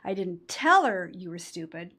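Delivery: strong emphasis landing on "tell"; stress on TELL. Shown as a demonstration. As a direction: hit the word "tell". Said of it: "If you emphasize the second word".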